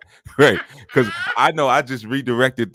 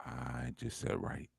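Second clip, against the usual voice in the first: quiet; 17 dB quieter, slower than usual. Real, not acted. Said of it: "what? I'm sorry. What?".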